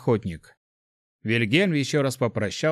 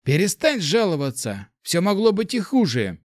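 higher, sharp rebuke; curt interruption; 7 semitones higher than usual. A narrator's reading. Mage cuts short young man's complaints, sharply. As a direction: cut him off firmly. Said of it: "резко оборвал его Вильгельм".

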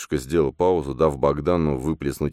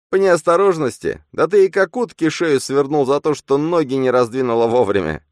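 much higher, louder taunting; a shrill inner tormentor; goading double; 12 semitones higher, 8 dB louder than usual. A narrator's reading. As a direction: needle him cruelly. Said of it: "подзуживал двойник".

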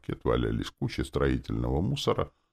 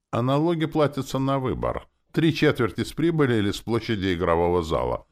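loud, high orc bark; snapping out loudly, pitch up; 9 semitones higher, 4 dB louder than usual. A narrator's reading. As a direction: bark the explanation at him. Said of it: "рявкнул орк".

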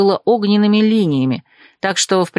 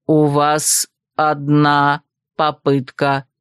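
low, very slow robotic announcement; slow system prompt on a low monotone; mechanical voice; 6 semitones lower, much slower than usual. A narrator's reading. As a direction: separate each word like a machine. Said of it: "И добавил".

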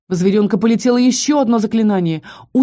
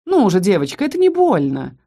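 ordinary delivery, nothing marked

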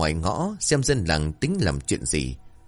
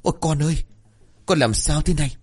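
high-pitched cry of a panicked mother; frightened cry; 8 semitones higher, faster than usual. A narrator's reading. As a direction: call out to the child in alarm, pitched well up. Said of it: "Bà sợ hãi rồi la toáng".

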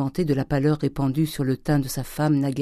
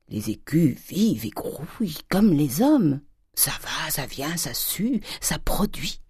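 slow, whispery miser's confidence; hushed relish; slower than usual. A narrator's reading. Miser revealing the life of money to his daughter, hushed. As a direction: half-whisper it slowly, savouring each word.

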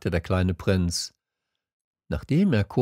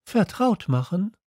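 high, questioning prince voice; little prince; 12 semitones higher than usual. A narrator's reading. Little prince asking the fox, high questioning voice. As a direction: ask it as a small curious prince, pitched up.

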